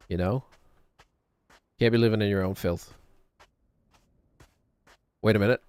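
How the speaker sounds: ordinary delivery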